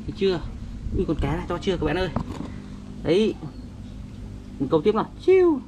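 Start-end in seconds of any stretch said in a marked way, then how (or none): 5.2-5.6 high squeaky whoosh for the cast; mouth sound effect. Acted. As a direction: zip the line out with a high "chiu". Toy fishing, casting sound. (tiếng vút cao)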